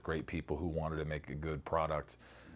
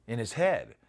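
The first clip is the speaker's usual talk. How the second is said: ordinary talk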